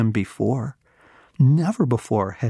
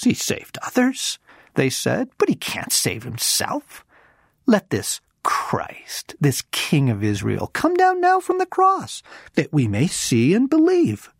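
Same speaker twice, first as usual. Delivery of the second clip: mocking; 8 semitones higher than usual. A narrator's reading. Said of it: "mocked him among themselves".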